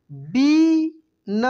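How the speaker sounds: बीन stretched very slowly with a long ई; drawn out. Shown as a demonstration. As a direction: hold the vowel of बीन long. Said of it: "इसके बाद देखेंगे यहाँ पे". (बीन को खींचकर बोलिए)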